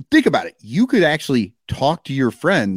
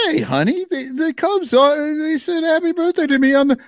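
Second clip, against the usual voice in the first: mock hurt player; 11 semitones higher than usual. Voicing the player to his wife.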